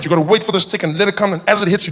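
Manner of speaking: ordinary talk, nothing marked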